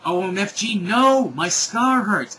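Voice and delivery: high, loud hammed-up scream, peaking on no; overacted scream of pain; mock scream. A narrator's reading. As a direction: wail it in mock agony, drawn out. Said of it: "he started to scream".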